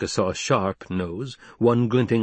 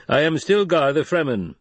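lightly voiced chief, pitched up; proud Fremen leader; 7 semitones higher, 4 dB louder than usual. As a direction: announce your name to strangers. Tall man announces himself.

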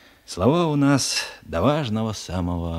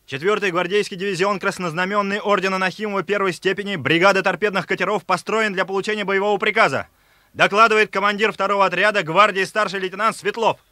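formal military report; 9 semitones higher than usual. Acted. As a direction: report at attention, crisp and formal.

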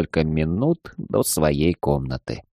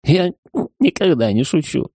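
higher, tipsy giggling boast; giggly drunk; 8 semitones higher than usual. Acted. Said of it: "пьяно хихикнул я".

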